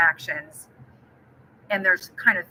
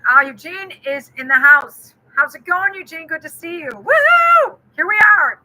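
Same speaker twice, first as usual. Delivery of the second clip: high and loud, calling out a welcome; excited welcome; 9 semitones higher, 9 dB louder than usual. Real, not acted. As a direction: greet a friend who just walked in. Greeting a viewer joining live.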